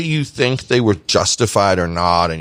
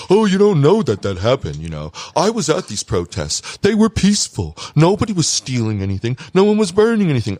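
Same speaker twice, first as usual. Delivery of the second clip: high-pitched parody of naive deniers; mocking imitation; 7 semitones higher than usual. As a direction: mimic them in a raised, whiny-protest pitch. Mocks people who deny riots.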